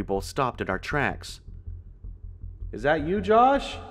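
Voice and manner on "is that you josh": high questioning shout from afar; distant father calling out; echoing call. A narrator's reading. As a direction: call down a hallway, pitch rising. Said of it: "a familiar voice echoed throughout the hallway".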